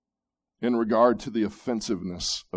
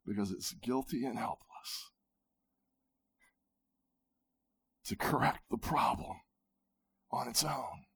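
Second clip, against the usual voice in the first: hushed, intimate sermon whisper; 4 dB quieter than usual. Acted. Preacher whispering to the congregation about pride needing grace. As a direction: lean in and whisper it.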